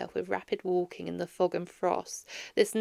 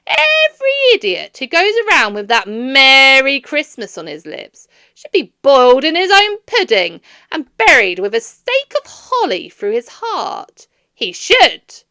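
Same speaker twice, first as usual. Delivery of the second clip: put-on loud, furious old man; indignant; 5 semitones higher, 14 dB louder than usual. A narrator's reading. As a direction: spit out the threat with outrage. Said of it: "said Scrooge indignantly".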